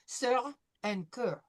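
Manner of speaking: Sir and que slow, R sounded at the end; French sir and que. Shown as a demonstration. Teacher slowly models Sir and que with final R. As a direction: draw out the R in Sir. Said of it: "don't forget that there's this R sound at the end".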